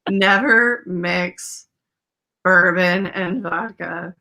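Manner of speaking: very slow solemn advice; slow and deliberate. Shown as a demonstration. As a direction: spell it out slowly to the kids. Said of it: "mock advice to kids".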